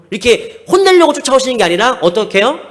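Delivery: ordinary delivery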